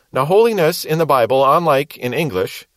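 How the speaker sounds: normal talking voice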